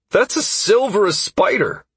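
high-pitched eager cry; excited exclamation. A narrator's reading. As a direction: burst out with glee at the find. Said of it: "Constantine exclaimed excitedly".

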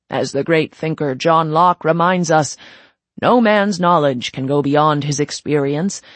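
loud, well-worn ceremonial drone; droning speech. Acted. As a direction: recite it like a speech given a hundred times. Princept continues his speech.

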